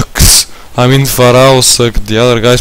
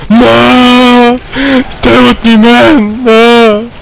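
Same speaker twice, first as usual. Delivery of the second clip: desperate high cry of no; man down shout; 10 semitones higher, much slower than usual. Real, not acted. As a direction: cry out as a teammate falls. Teammate dying in game.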